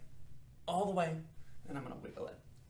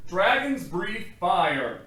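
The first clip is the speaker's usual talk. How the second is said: slow, loud, stern scolding; stern dragon father; 3 semitones higher, 10 dB louder, much slower than usual. Acted. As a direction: lay down the law slowly. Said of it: "shouted his father".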